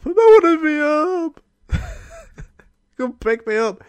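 put-on high, whining little kid; whiny homesick child. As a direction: beg to be picked up like a kid. Homesick kid calling parents.

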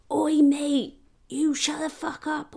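high, loud mock confrontation; aggressive audience man. Another punter squaring up to the French man, aggressive. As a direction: call out a loud, high challenge. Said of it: "this other guy, he got up".

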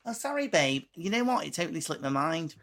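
mock-innocent apology to the tired partner; breezy careless excuse. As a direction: brush it off lightly.

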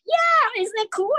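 viel höher, begeistert; excited to a kid. Retold or imitated. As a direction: cheer up a curious kid. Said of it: "I can tell that from my experience".

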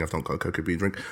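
usual speaking voice

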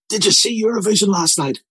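high, eager question to neighbours; buzzing with the news; eager gossip. Retold or imitated. Neighbours after mass asking eagerly about the contest.

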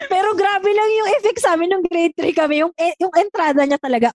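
ordinary delivery

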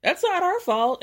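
mock shrill retort. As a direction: shriek back at her as a joke. Mock reply to screaming Jen.

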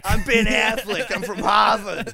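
a put-on Boston drawl, dropping the r; Ben Affleck Boston accent. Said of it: "he always goes".

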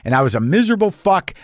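ordinary talk

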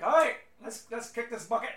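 loud, high Scottish dwarf cheer; rally the crew in a Scottish accent. Acted. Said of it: "I don't have the best Scottish accent".

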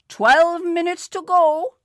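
put-on high, loud old man's shout; old man in a Panama hat shouting back the time. A narrator's reading. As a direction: shout the answer down the bus. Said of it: "shouted back the old man".

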